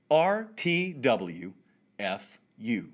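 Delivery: R T W F U very slowly, letter by letter; spelled out slowly. Shown as a demonstration. Host spells the acronym for those who don't know it, slowly. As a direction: draw out R T W F U with gaps between the letters. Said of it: "let me say it slowly".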